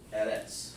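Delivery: "Eretz" in two syllables ending in ts; mimicked Yiddish pronunciation. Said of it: "They talk like that".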